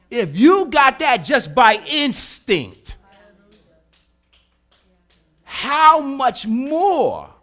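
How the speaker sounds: loud, slow climax over the organ; full-force preaching. Real, not acted. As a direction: let it ring out slowly.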